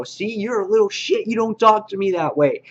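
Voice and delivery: a lightly voiced kid refusing to back down; defiant Carl. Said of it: "he's not taking any of it".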